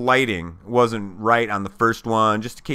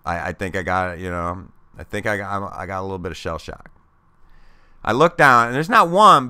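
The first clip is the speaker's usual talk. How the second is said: ordinary delivery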